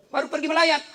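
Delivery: ordinary talk, nothing marked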